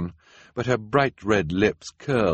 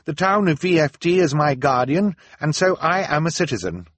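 higher, firm resolve; Violet, firm; 9 semitones higher, 5 dB louder than usual. A narrator's reading. Violet addressing the council, firm. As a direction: stand your ground. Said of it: "she said".

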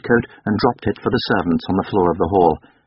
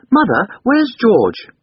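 put-on high, loud, slow child's voice; little boy asking; 5 dB louder, slower than usual. A narrator's reading. Boy asking his mother about George, high childish query. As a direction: pipe up slowly like a small boy.

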